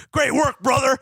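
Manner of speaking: high, hyped-up sportscaster; excited mock sports-host praise; Pat McAfee. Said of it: "Pat McAfee did mention it".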